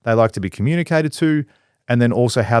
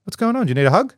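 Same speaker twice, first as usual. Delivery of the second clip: much higher, gentle mock soothing; calming an angry boss, lightly voiced gentle; 11 semitones higher than usual. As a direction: coax him like a friend. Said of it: "I say".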